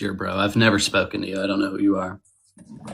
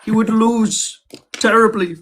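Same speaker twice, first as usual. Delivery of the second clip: Jordan Peterson; 11 semitones higher than usual. Acted. Debater doing Jordan Peterson about Liquid Zulu, high-pitched impression. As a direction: pitch it way up, drawing out the words. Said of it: "I'll do a Jordan Peterson".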